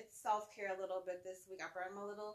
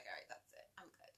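much softer, breathy voiced-off dismissal; nearly unvoiced, much quieter little boy; whispered kid brush-off; 16 dB quieter than usual. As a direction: mumble it like a kid done with hugs. Son pushing off after cuddle.

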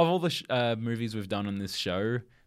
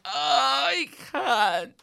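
slow, high choked-up cry; tearful; 5 dB louder, much slower than usual. Retold or imitated. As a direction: break down, too upset to go on. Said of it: "And I was just like".

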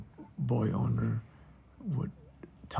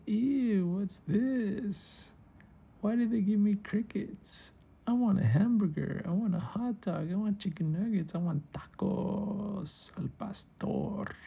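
high, grossed-out whiny gecko; high-pitched indignant moaning; disgusted whining; 8 semitones higher than usual. Acted. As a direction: complain like a picky eater served bugs. Gecko disgusted by crickets, demands food.

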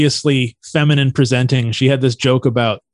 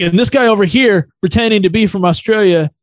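higher-pitched comic pointing out a heckler; imitated stage call-out; 7 semitones higher, 3 dB louder than usual. Comedian calling out heckler on stage.

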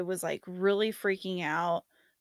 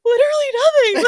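squealed high and loud through laughter; laugh-cry it out, much higher and louder; a helpless laughing squeal; 12 dB louder, much faster than usual. Retold or imitated. Insisting nothing is wrong, crying.

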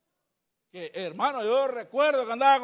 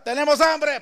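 prisoners' complaint; 4 semitones higher, 7 dB louder than usual. Retold or imitated.

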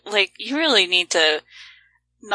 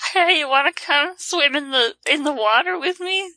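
sly, higher creature voice luring you in; luring water horse; 6 semitones higher than usual. Pretend. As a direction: coax them into the water like a sly horse spirit. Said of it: "That's the Kelpie's voice now".

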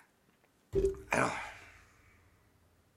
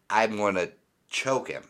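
ordinary delivery

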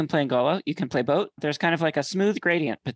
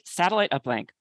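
usual speaking voice

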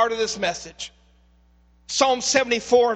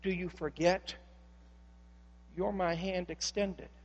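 near-whispered, hushed and low; soft voice of God; 8 semitones lower, 16 dB quieter than usual. Acted. God answering the pleading psalmist, in a hushed low voice. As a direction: speak it hushed, like a gentle secret. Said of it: "God would say".

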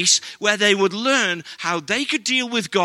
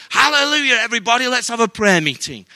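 a hammed-up high, loud "Hallelujah"; mock overzealous shout; 4 semitones higher, 6 dB louder, faster than usual. Pastor mocking a coworker starting a revival, hammed-up shout. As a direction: play the over-keen believer at work, fast.

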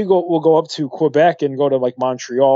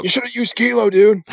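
mock know-it-all; 8 semitones higher than usual. Lost pilot told off.

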